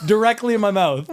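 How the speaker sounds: repeating it high and loud through laughter; real giggling disbelief; still laughing. Real, not acted. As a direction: squeal the punchline again, laughing hard. Repeats punchline while laughing.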